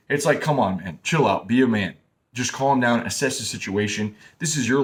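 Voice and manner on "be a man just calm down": ordinary delivery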